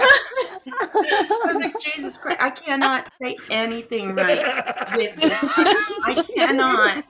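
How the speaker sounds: loud, breaking into laughter; laughing exasperation. Retold or imitated. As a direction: exclaim it through helpless laughter. Retells exasperation while laughing.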